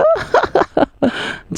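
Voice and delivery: high, gleeful scheming laugh; boy laughs after fooling his mother; sly cackle. Acted. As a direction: cackle at your own trick. Boy gloats after fooling mum.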